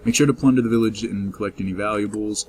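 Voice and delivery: ordinary delivery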